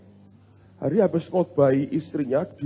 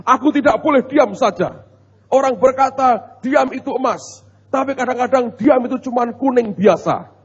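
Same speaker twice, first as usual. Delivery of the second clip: higher-pitched, emphatic mock proclamation; husband lecturing his wife; 7 semitones higher, 5 dB louder than usual. Husband home from a seminar tells his wife he rules, mock preachy. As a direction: imitate a newly self-appointed boss laying down the law. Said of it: "dia ngotbahi istrinya".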